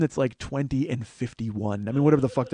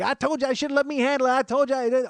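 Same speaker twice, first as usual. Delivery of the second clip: high-pitched whiny imitation; little sidekick protesting in a high voice; short dude; 10 semitones higher than usual. Short dude answering the yelling boss, high imitated voice. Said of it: "And the short dude is like".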